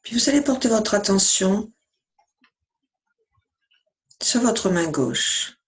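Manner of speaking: even, measured meditation voice with long pauses; guided meditation. Acted. Yoga teacher guiding listeners lying down through yoga nidra, calm meditation voice.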